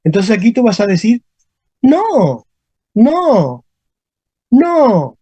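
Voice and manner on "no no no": each no starts high and drops; falling no. Shown as a demonstration. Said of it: "De arriba abajo".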